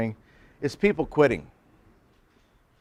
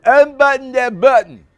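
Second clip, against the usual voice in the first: much higher and louder insistent repeat; mimicking classmate; 11 semitones higher, 10 dB louder than usual. Classmate repeats the order more insistently, imitated. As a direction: repeat the order, pushing harder. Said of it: "I'm gonna mimic him".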